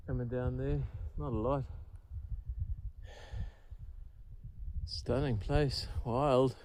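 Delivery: hushed, soft voice by the water; fishing whisper. Real, not acted. Quiet lakeside talk near fish.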